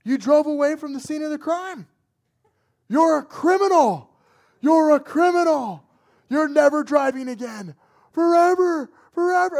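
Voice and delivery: loud, high yelling in someone's face; furious dad. Retold or imitated. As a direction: yell it like an enraged dad. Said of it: "he says ... he's just, like, in my face".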